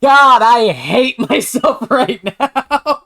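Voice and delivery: laughing. Real, not acted. Laughs at himself.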